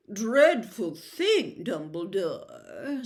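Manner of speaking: slow, lazy drawl. Acted. Malfoy lazily greets the headmaster, slow and bored. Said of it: "said Malfoy lazily".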